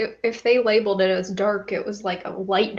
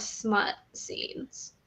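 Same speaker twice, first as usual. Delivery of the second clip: whisper; 2 semitones lower, 9 dB quieter than usual. Real, not acted. Embarrassed soft word.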